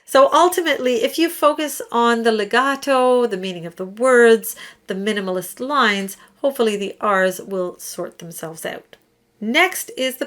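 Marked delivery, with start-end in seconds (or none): none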